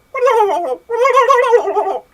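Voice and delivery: high-pitched warbling gobble; loud prank noise. Retold or imitated. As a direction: trill wildly at full volume. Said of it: "like, really loud".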